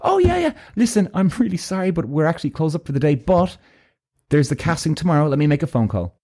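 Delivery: high, apologetic receptionist voice; starts very high, settles gradually. Retold or imitated. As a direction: imitate a helpful woman at the front desk. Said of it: "She goes".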